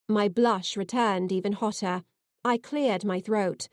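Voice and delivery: plain reading voice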